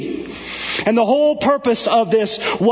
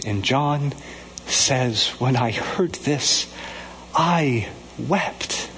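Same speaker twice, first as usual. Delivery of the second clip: hushed reflective preaching; 6 semitones lower, 4 dB quieter than usual. Acted. Preacher pondering John's weeping before God, hushed. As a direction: draw them in quietly, pausing on the weeping.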